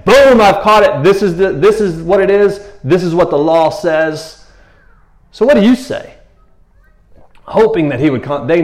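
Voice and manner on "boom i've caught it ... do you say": loud, high, challenging accuser. Retold or imitated. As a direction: lay out the charge like a prosecutor. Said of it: "the accuser himself".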